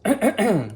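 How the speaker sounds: harsh, loud coughing fit; real choking cough. Real, not acted. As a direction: cough hard, choking on food. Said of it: "I'm choking on walnuts".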